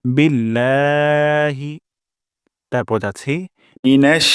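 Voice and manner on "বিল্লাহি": বিল্লাহি with the la stretched slowly; long la in বিল্লাহি. Shown as a demonstration. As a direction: hold the লা in বিল্লাহি. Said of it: "এক আলিফ পরিমাণ টান দিব".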